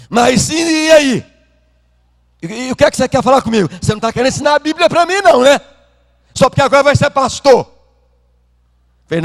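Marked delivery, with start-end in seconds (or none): 0.1-7.7 indignant father. Retold or imitated. Father scoffs at son's plea.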